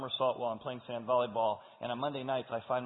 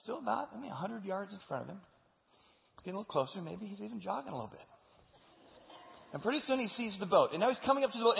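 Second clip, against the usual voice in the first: ordinary talk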